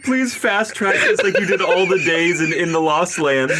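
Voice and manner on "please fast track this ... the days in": usual speaking voice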